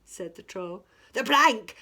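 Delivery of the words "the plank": loud, higher "The plank!"; yelling. A narrator's reading. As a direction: pirates baying for the plank. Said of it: "yelled Ben Buckle and Percy Patch".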